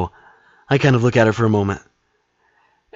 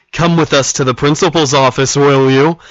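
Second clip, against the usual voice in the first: mock stern teacher voice; 7 semitones higher, 8 dB louder than usual. Teachers summon the kid, voiced.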